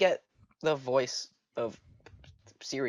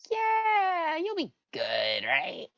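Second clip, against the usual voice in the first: mock reassurance; 3 dB louder, much slower than usual. Mocking reassurance in quote.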